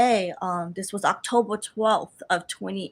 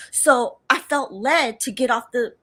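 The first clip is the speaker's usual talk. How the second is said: usual speaking voice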